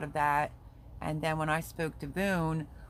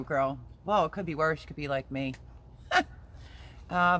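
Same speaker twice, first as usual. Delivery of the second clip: usual speaking voice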